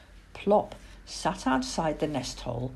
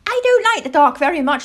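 high, loud, quick little owl voice; piping child-owl confession; confiding owlet; 11 semitones higher, 10 dB louder, faster than usual. Acted. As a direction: blurt it out as a young owl. Plop confesses his dislike of dark.

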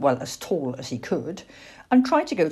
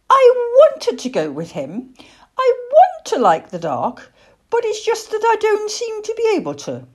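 very high, plaintive little-owl voice; upset owlet pleading to his mother; high-pitched childlike protest; young owl Plop, distressed; 6 dB louder than usual. Acted.

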